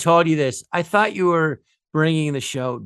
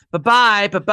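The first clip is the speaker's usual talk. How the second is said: David Spade; 4 semitones higher, 3 dB louder than usual. Retold or imitated. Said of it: "you had David Spade going".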